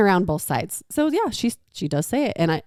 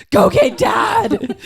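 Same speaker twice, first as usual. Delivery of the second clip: high, slow, loud whine through laughter; laughing whine; 13 semitones higher, 4 dB louder, much slower than usual. Pretend. Mother plays a whining kid begging for Dad, laughing. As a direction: beg for Dad while laughing.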